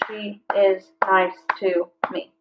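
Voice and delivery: slow, each syllable spaced out; slow syllable-counting read. Shown as a demonstration. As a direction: clap out each beat. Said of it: "That's five".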